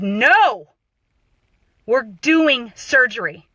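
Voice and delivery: loud, drawn-out no, then slow words; slow, emphatic retold refusal; firm refusal. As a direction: imitate a doctor shutting down a plea. Doctor refusing the begging patient.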